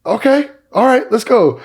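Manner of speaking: very high, loud imitation of someone taken by surprise; high-pitched mock hype; startled mock exclamation. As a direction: squeak it out loudly, imitating his surprise. Voicing a teammate's startled reaction.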